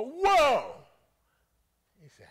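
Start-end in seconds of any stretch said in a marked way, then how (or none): 0.0-0.9 sudden loud high cry of wonder; a big shout of sudden discovery; amazed whoa. Retold or imitated. Preacher acting out reading scripture, loud amazed whoa. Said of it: "You go".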